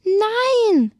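high, loud scream of no. Acted. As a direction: scream in terror. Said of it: "schreit die Hexe".

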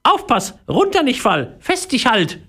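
very high fussy parrot chatter. Acted. Parrot fussing at the boy climbing the tree. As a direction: babble warnings like a worried parrot. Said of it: "unaufhörlich plapperte".

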